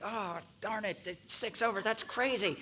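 quiet imitated family grumbling about a small ticket; mock disgust. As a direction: grumble it under your breath, half-hearted outrage. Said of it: "being disgusted and outraged".

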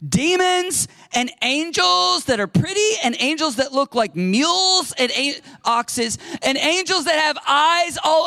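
high, loud, impassioned sermon; fervent preaching. Acted. Preacher urging his flock to read the Bible, fervent and loud. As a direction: preach it out like a revelation. Preaching at full force, rising passion.

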